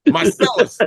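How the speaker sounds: playful high-pitched impression; high mock voice.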